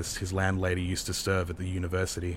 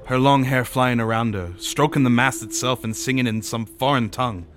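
higher, emphatic rustic sailor; sailor telling a yarn; 3 semitones higher, 4 dB louder than usual. Acted. Sailor recalling the ghost woman, yarning. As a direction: tell it like an eager witness.

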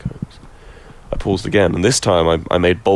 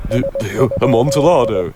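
astonished cry; 3 semitones higher, 5 dB louder than usual. A narrator's reading. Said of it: "ejaculated my friend".

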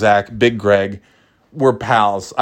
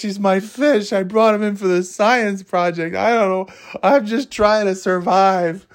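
put-on higher, anxious little-kid whine; whiny younger self; 10 semitones higher than usual. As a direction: plead like a panicky schoolboy. Younger self pleading over fish.

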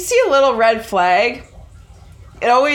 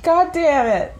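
hammed-up furious cook, higher voice; mock kitchen rage; 4 semitones higher than usual. Playing the angry cook from her co-host's story, mock rage. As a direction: act out a cook cursing someone out of the kitchen.

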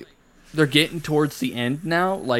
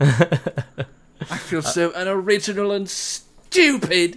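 slow, higher mock wail; hammed-up dismay; 5 semitones higher, much slower than usual. Friend made a reference unknowingly, mock-miserable whine. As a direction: whine it slowly in put-on misery.

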